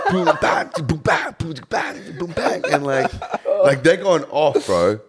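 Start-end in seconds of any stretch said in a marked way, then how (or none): 0.1-2.6 high, laughing boom-bang beat imitation; beatbox the set like it's pumping; mock beat noises. Imitating the music.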